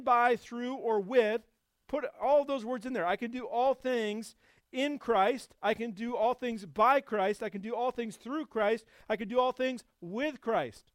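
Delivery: loud, high, driving sermon delivery; full-force preaching. Acted. Pastor preaching on Greek words, loud and high. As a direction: preach it out with mounting fire to the congregation.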